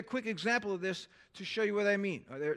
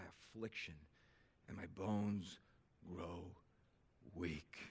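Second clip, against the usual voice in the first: slow, soft, lower scripture lament; hushed psalm reading, fading quieter; 10 semitones lower, 8 dB quieter than usual. Acted. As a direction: read it like a grieving psalm, trailing away. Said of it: "I'll read this".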